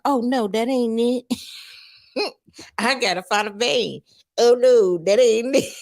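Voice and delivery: high, slow, strung-out drawl; mock addict voice. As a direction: voice the addict fumbling for a vein. Retelling addict searching for vein.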